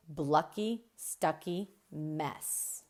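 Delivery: ordinary delivery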